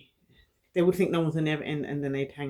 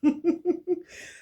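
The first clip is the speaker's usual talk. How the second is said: laugh; 4 dB louder than usual. Real, not acted. Laughing at own joke.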